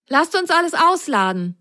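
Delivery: high sock voice; Ron's voice. A narrator's reading. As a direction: call to get started. Ron voiced in story.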